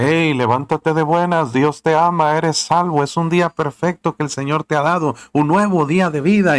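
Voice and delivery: high, loud wake-up call, bright and eager; joyful shout. Acted. Wisdom shouting good morning to the listener, loud and joyful. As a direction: shout the good news to rouse a sleeper. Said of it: "sabiduría te grita".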